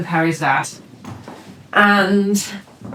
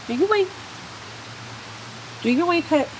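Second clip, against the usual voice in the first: high cooing pet voice; a high sing-song voice for the dog; coaxing the puppy; 8 semitones higher, 5 dB quieter, faster than usual. Real, not acted. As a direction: coax a little dog sweetly.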